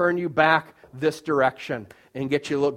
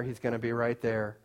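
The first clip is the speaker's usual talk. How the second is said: much softer, a near-hushed repeat; dropping softer; 6 semitones lower, 9 dB quieter than usual. Acted. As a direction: let it fall away softly. Repeating the line, fading.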